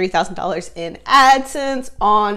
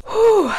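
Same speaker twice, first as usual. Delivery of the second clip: high, loud exhaled whew; sharp high sigh of nerves; nervous whew; 11 semitones higher, 5 dB louder than usual. Real, not acted. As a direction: let out a big bracing breath. Bracing before paying off debt.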